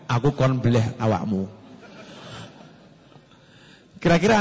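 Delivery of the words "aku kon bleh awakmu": slow, lowered father's voice; father's quiet line. Acted. Father tells son he must be slaughtered, low and slow. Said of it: "terus jenengan ngomong".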